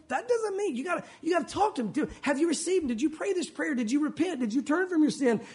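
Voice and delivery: normal talking voice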